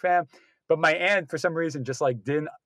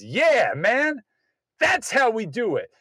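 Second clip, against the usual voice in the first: rowdy cheer; 6 semitones higher, slower than usual. Retold or imitated. Moviegoer cheering in theater.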